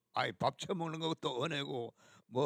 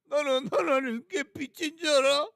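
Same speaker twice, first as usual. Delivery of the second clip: higher, louder sneering imitation; mocking quote; 10 semitones higher, 6 dB louder than usual. Preacher mocking proud pastors in a jeering shout. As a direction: mimic the proud pastor with scorn. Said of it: "그 따위 생각을 하겠어".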